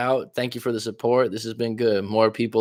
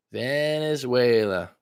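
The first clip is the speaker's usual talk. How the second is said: slow, stretched word gliding down; lingering, playful drawl; drawn-out echo; 3 semitones higher, 3 dB quieter, much slower than usual. Pretend. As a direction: stretch the country name out long without shouting. Same word again, softer and stretched.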